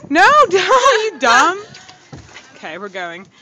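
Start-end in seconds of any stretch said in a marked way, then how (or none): 0.1-1.6 loud laughing protest; laughing shout. Real, not acted. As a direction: yell no while laughing. Friend about to drop something.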